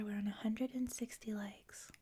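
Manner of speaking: usual speaking voice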